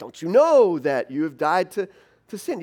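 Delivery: loud, incredulous repeat of Paul's words; mock indignant quote. Reader quoting Paul back in loud disbelief. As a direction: throw his own words back at him. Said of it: "you're supposed to go".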